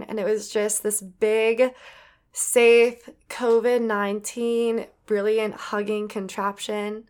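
ordinary talk, nothing marked

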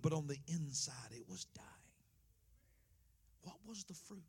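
soft dropping whisper for the punchline; whispered reveal. Acted. Preacher whispering the hidden truth to the church. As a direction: lean in and whisper the secret. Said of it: "whispered reveal".

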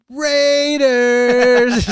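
put-on high, loud, stretched kid shout of the team name; imitating his daughter. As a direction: draw the word out like a proud little fan. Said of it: "she'll be like".

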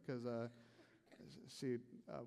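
usual speaking voice

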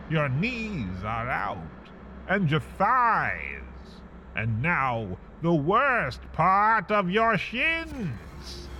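usual speaking voice

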